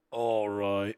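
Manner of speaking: attempted country accent slipping into Australian; drawn-out, botched Australian-sounding accent; accidental Australian accent. Shown as a demonstration. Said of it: "that, that's Australian".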